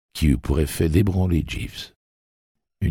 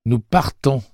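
raised, firm statement; firm declaration; 9 semitones higher, 4 dB louder than usual. A narrator's reading. Eddie announcing their departure, firm and raised. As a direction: state it flatly at gunpoint. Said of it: "déclara-t-il".

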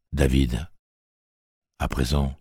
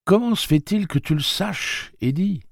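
voix féminine bien plus haute; Susannah curieuse. Acted. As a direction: interroge avec douceur. (Susannah questionne Eddie, intriguée)

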